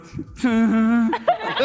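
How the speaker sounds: loud mock noise of a drunk friend; Steve, drunken noise. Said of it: "That was a good Steve impression".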